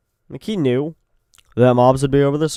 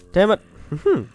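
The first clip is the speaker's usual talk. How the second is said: usual speaking voice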